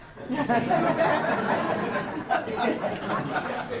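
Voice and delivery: long breathy laugh that falls away; long airy laugh. Real, not acted. Amused by his own pitch to the boss, long airy laugh. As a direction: laugh hard at your own joke.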